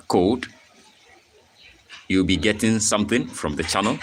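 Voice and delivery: ordinary talk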